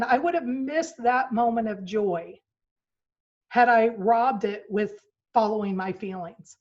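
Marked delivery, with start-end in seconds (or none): none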